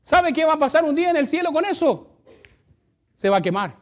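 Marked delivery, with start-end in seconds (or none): 0.0-2.2 loud, high preaching call; preaching at full force. Acted. Pastor preaching hard to the church. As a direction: preach it out to the congregation.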